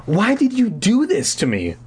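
shrieked. A narrator's reading. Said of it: "I shrieked".

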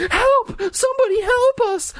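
very high, panicked scream; screaming for help. A narrator's reading. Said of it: "I heard Mike scream".

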